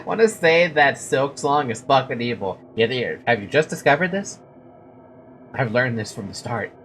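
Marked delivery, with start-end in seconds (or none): none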